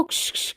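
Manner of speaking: soft unvoiced shh; doctor hushing the family in a hospital; shushing. A narrator's reading. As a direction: hiss them quiet.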